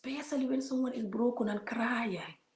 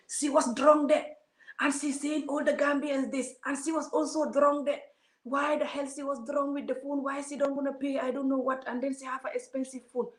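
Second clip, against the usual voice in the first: lightly voiced, raised mocking of the critics' gossip; mocking commenters; 7 semitones higher than usual. Vlogger mimicking commenters who gossip about the drunk woman, mocking. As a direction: parrot the commenters with a higher, sneering lilt.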